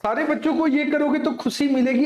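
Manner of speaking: usual speaking voice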